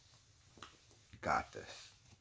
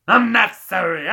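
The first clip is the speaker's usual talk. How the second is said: smug not sorry; 7 semitones higher, 5 dB louder than usual. Pretend. Cheeky punchline.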